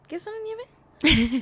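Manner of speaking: mom offering ice cream. Retold or imitated. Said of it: "she would turn around and be like".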